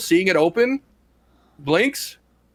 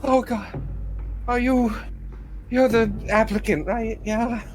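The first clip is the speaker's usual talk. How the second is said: lightly voiced flustered woman; flustered young woman; 9 semitones higher than usual. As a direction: raise the voice, still shaken. NPC voice high.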